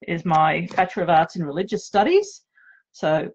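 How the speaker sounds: ordinary talk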